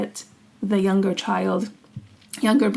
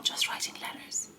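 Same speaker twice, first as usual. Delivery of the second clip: hushed whisper; 13 dB quieter than usual. Real, not acted. Hushed retelling of scandal.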